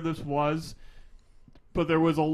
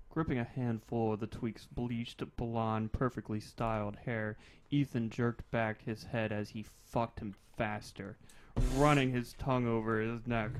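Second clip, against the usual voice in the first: story narration; 5 semitones lower, 8 dB quieter than usual. Acted. Reading erotic fiction aloud.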